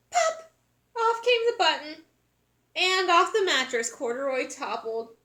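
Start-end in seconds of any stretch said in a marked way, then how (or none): 0.1-2.0 excited narration. A narrator's reading. Button pops off.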